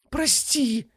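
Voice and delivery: very high strangled yelp; choked cry. Acted. Ifrit begs his strangler's pardon, choked. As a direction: squeal it as if held by the throat. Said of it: "захрипел Кебаб".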